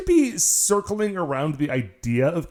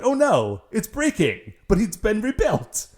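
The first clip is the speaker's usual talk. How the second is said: higher-pitched, lightly acted dismay; mock alarm; 6 semitones higher than usual. Podcaster voicing the Rangers seeing a rebuilt enemy, mock alarm. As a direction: imitate heroes in a hammed-up panic.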